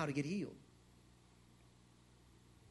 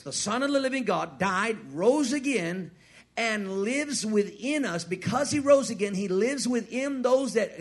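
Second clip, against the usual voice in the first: rising, louder preacher's declaration; preaching at full force; 3 semitones higher, 6 dB louder than usual. Acted. Preacher proclaiming the risen Jesus to the congregation, full force. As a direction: build the proclamation, louder and higher.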